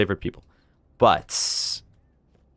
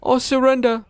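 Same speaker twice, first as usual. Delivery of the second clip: mock surrender; 4 dB louder than usual. Streamer voices a quote.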